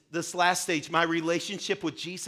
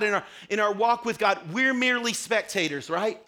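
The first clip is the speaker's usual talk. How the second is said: usual speaking voice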